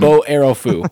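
loud mock martial arts cry; mock kung fu shout. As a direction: yell it like a fighting move.

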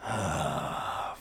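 low, heavy exasperated sigh; weary sigh. Acted. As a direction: sigh like a fed-up villain. Orc boss losing patience.

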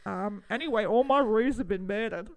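put-on Australian storyteller; explain, then lament in Australian; Australian accent. Kangaroo farmer explaining a pun and a loss, in an Australian accent. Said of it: "Now we're back to the Australian accent".

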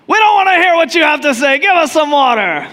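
high, loud, fast heckling shout; crowd shouting. Retold or imitated. Thirsty crowd shouts down Moses, loud and fast. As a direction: drown him out, impatient and rude. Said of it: "somebody in the crowd shouts out".

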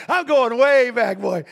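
loud, slow, laughing; laughing loudly. Real, not acted. Preacher laughing at himself, loud and slow.

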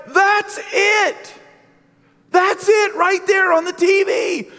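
high, loud, frantic shout; shouting to wife. Retold or imitated. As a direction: yell it at the TV in alarm. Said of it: "and I told my wife".

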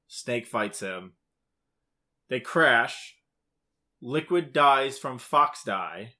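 ordinary delivery

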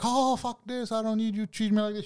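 high, hurried put-on protest; mock upset coworker. Coworker quitting the quarrel in a huff, mock upset.

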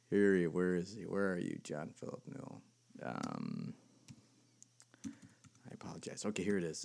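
very soft, low absent-minded mumble; muttering to himself. Real, not acted. Host typing to look up a website, muttering to himself. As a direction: talk under your breath while searching. Searching on computer, talking to himself.

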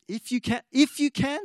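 Jesus indignantly echoing the doubting father. Retold or imitated. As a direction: throw the father's words back in disbelief. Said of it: "see the indignation in his voice".

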